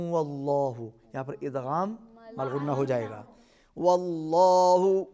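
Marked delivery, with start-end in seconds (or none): 3.8-5.0 slow, measured tajweed chant; teacher modelling a recitation; Quran recitation. Shown as a demonstration. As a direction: recite it slowly for the pupil to copy. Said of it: "teacher models verse".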